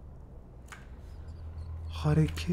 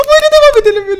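much higher and louder, stretched out; exaggerated loud question; 9 dB louder, faster than usual. Shown as a demonstration. Vlogger asking viewers to vote, calling out high and loud. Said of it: "Bir şey söyleyeceğim".

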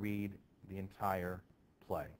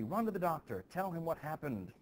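urgent order; 7 semitones higher than usual. Acted.

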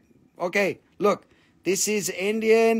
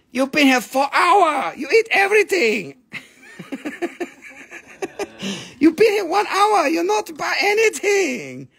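mock scolding shopkeeper; 8 semitones higher than usual. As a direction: scold the lingering customer in a high pitch.

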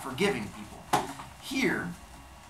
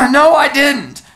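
higher, much louder forced denial; boy forcing out a loud denial to the big guy; almost shouting; 11 semitones higher, 17 dB louder, slower than usual. Acted. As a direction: overcorrect into a shout. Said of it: "So loud that it sounded like I was shouting".

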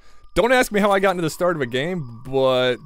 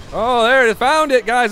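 high, loud excited call-out; sudden discovery; 8 semitones higher, 7 dB louder than usual. Real, not acted. Player who found the charger alerting teammates, high and loud. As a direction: burst out high as you spot it.

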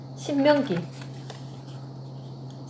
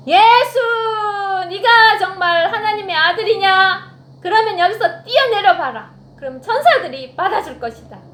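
loud, higher taunting devil voice; 사탄의 유혹; 5 semitones higher, 6 dB louder than usual. Acted. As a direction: tempt him like a swaggering devil. 사탄이 예수를 시험하는 대사. (크고 높게 비아냥대는 사탄 목소리)

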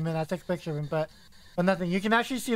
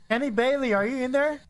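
raised voice, calling the name; 7 semitones higher, 7 dB louder than usual. Real, not acted. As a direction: call into the room. Calling out to the ghost.

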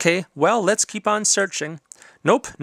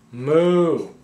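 cow moo. Pretend. Cow noises in a picture book.

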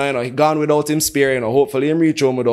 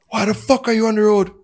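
angry cop; 8 semitones higher than usual. Retold or imitated. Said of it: "So now he goes".

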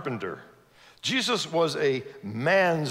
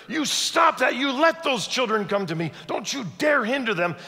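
roaring in anger; 8 semitones higher than usual. Acted. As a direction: roar it out like an outraged rabbi protecting the kids. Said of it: "He roared in great anger".

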